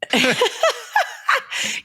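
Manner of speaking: squealing laugh, high; high shriek of laughter. Real, not acted. Friend squealing with laughter at the teasing.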